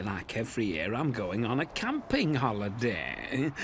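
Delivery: ordinary talk, nothing marked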